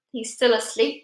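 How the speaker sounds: ordinary delivery, nothing marked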